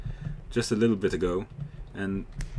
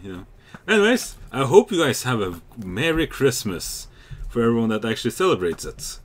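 ordinary talk

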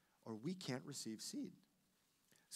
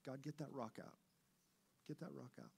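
hushed pleading prayer; much softer, earnest prayer; quiet prayer; 9 dB quieter than usual. Retold or imitated. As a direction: softly ask God to remove it.

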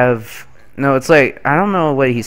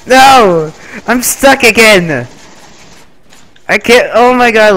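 frustrated shout; 6 semitones higher, 6 dB louder than usual. Real, not acted.

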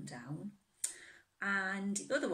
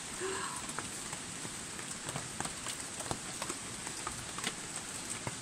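long breathy gasp of wonder; 3 dB quieter than usual. A narrator's reading. Teacher voicing Robin amazed at the snow, long gasp. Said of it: "he gasped".

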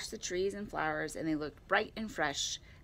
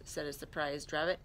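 ordinary delivery

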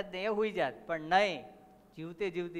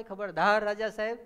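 louder, milder replay of the warning cry; retold warning shout; 3 semitones higher, 6 dB louder than usual. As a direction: call out the warning, half at full force. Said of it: "રાજાને સીધી બૂમ પાડી". (ચેતવણીની બૂમની નકલ)

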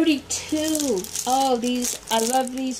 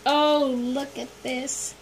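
ordinary talk, nothing marked

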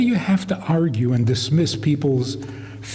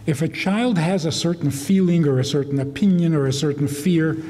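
ordinary talk, nothing marked

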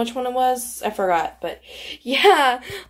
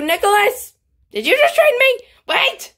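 much higher, louder call across the game; 10 semitones higher, 4 dB louder than usual. Real, not acted. Player calls out to Nicholas who traded her, loud call. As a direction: shout his name to catch him.

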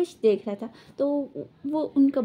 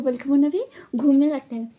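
usual speaking voice

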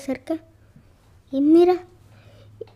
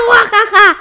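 loud, high cackling muajaja; evil witch laugh; 7 semitones higher, 8 dB louder than usual. Acted. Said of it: "se ríe malvadamente hace muajaja".

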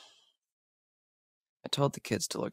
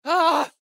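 sharp high yelp of fright, much louder; startled scream; 11 dB louder than usual. Acted. Startled by a sound in the dark, a loud high yelp. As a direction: jump and yelp at the sudden noise. Sudden scare.

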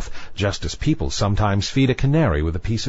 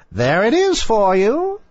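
high, slow witch voice; high-pitched witch, savouring it; sea witch; 12 semitones higher, 3 dB louder than usual. A narrator's reading. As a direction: hand it over as a witch, drawn out. Witch hands over the draught.

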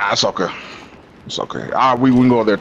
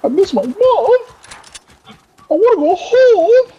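high, slow whimpering mock child; hammed-up crying child begging to go home; mock scared kid; 3 dB louder than usual. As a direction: tease him as a homesick little kid. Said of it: "You said".

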